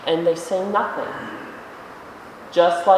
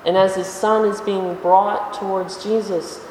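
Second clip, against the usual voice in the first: usual speaking voice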